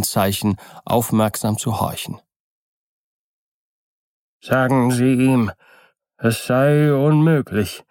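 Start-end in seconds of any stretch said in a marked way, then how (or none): 4.4-7.8 drawn-out, plaintive voice from within; plaintive, weak voice. A narrator's reading. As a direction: answer feebly, stretching the words in complaint. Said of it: "eine klagende, schwache Stimme von innen". (klagende, schwache Stimme)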